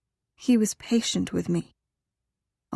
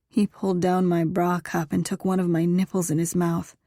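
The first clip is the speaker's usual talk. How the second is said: ordinary delivery, nothing marked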